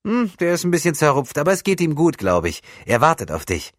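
put-on high, loud boyish voice, offhand; grinning camp lad. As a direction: play a cheeky lad making light of it.

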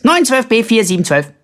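high, loud burst of numbers; rattling off rapidly. A narrator's reading. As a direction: butt in with a racing code. Simpel butts in with random numbers.

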